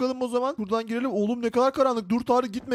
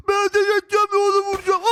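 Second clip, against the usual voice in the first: normal talking voice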